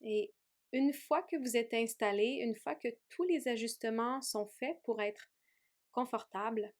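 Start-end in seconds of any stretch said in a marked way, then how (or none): none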